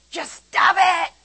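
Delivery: high, loud, slow snapped order; harsh command. Retold or imitated. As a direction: bark it like a losing-patience command. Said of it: "We think if I say".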